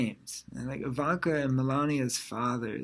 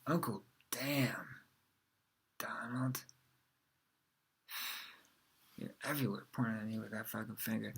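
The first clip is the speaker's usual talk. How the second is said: much softer, weary grumble; muttered exasperation; 4 semitones lower, 10 dB quieter than usual. Acted. Complaining at Trump's pointing picture, quietly exasperated. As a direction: grumble it under your breath.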